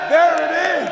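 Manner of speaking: loud, high triumphant call; loud exclaim. Real, not acted. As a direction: shout it out in triumph. Shouting to the crowd.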